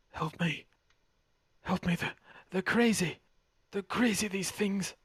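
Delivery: high-pitched, panicky captive voice; frightened plea. Acted. Caged captive pleads for help.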